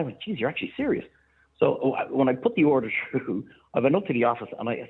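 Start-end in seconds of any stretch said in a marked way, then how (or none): 0.2-1.0 surprised. Retold or imitated. Disbelieving reaction, much higher.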